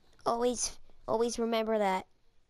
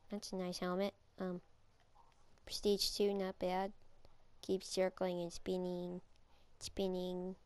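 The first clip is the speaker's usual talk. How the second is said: whisper; 3 semitones lower, 8 dB quieter than usual. Real, not acted. Quietly commenting on opponent.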